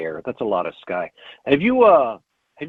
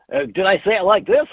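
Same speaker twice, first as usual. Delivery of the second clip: put-on announcer voice, high and loud; radio voice; 8 semitones higher, 6 dB louder than usual. Shown as a demonstration. Co-host replaying a laughed-at line, exaggerated radio voice. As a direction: project it like a hammed-up radio host. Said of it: "totally in a radio voice".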